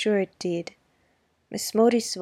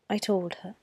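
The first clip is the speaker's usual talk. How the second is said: ordinary delivery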